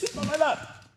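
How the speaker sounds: high-pitched mimicry of Dad's accent; father's accent. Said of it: "that, that accent is fly".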